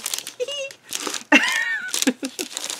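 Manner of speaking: high giggling burst of glee; squealing laugh of excitement; excited laugh. Real, not acted. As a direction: giggle with real delight. Giddy over scrapbook page.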